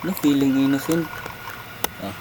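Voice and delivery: ordinary talk, nothing marked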